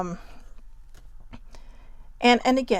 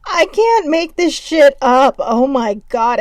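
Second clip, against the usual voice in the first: higher and louder, slow and laughing through the words; 7 semitones higher, 9 dB louder, slower than usual. Real, not acted. Reading the taco card to viewers, laughing hard. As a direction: get more and more tickled as you go.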